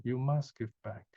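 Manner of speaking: ordinary talk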